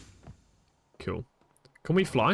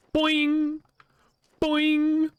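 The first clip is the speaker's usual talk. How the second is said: cartoon boing; 11 semitones higher than usual. Mimicking a bounce.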